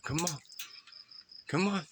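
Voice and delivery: high, rising coaxing call; coaxing a wild bird. Real, not acted. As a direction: lift the pitch, gently urging.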